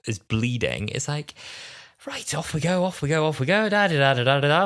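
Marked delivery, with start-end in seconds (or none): 2.1-4.7 high, breezy sing-song; mock cheery Doctor humming along; chirpy Doctor. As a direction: skip off without a care. Breezy Doctor leaving River, retold.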